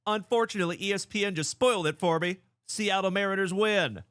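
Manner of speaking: bursting to share the result; excited. Real, not acted. Streamer tells viewers ESPN spoiled the win, excited. Spoiler reveals team won.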